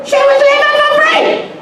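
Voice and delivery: high, excited cry of disbelief; exclaiming. Real, not acted. Telling the audience about the aunt, a high excited cry. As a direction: burst out with it, way up high. Punchline about aunt's free living.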